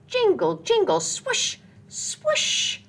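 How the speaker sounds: high squeaky jingle, then a breathy swoosh; squeaky sleigh sounds. Acted. Reading to a child, squeaky high sleigh sounds. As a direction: squeal the bells and hiss the swoosh as it speeds.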